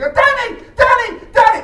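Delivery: high, loud calling out of a name; piercing call. Retold or imitated. Woman in the street calling out to the comic, high and loud. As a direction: yell the name across a busy street. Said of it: "as loud or as piercing or as intrusive".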